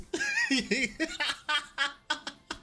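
long, high cackling laugh; laughing fit. Real, not acted. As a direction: lose it completely in high bursts. Breaks up retelling own fall.

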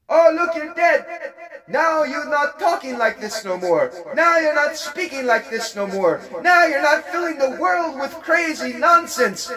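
high, mocking sneer at a dead man; sing-song mockery of a fallen false prophet; gloating taunt. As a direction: crow over the body, jeering and shrill with glee.

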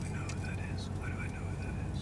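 hushed unvoiced whisper. Real, not acted. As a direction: whisper it under your breath. Recognizing someone, whispered.

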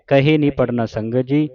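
ordinary talk

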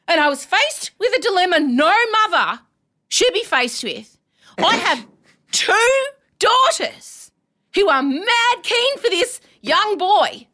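hammed-up maternal despair; mock melodrama. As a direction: wail it out like a tragic heroine. Mother's dilemma told as melodrama.

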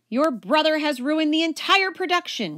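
high, loud shriek of outrage; shrieking teacher. A narrator's reading. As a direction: shriek it like a ruined director. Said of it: "she shrieked".

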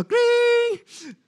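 very high, sustained ringing cling; imitated emergency bell. Hospital emergency bell going off, imitated high ring. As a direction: mimic a shrill alarm bell with the voice. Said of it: "somebody pressed the emergency bell".

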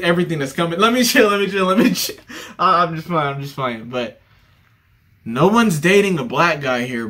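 ordinary talk

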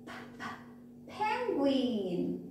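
two breathed p puffs, then "penguin"; isolated p sound. Shown as a demonstration. Teacher showing children the first sound, isolated p. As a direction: sound out the p twice before "penguin". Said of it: "all of these has p sound".

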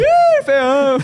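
slow, high mocking jeer with a laugh; mock a son's looks like a teasing father; teasing dad. Dad teasing his son's beard, slow high mocking laugh. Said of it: "Meu pai me passa de pai".

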